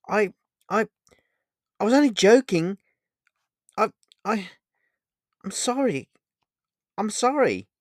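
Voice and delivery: high, stammering, frantic apology; desperate apology. A narrator's reading. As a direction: beg to be believed, tripping over the start. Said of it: "desperately trying to convince Vega".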